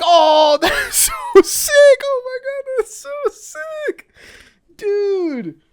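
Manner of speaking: very high, slow stretched squeal; real high-pitched delight; ecstatic squeal. Real, not acted. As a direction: gush over the gift. Sees the scarf.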